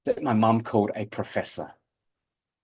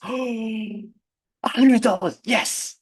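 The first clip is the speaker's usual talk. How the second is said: excited; 12 semitones higher, much slower than usual. Retold or imitated. Kid imagining a reward.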